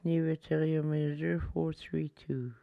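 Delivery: normal talking voice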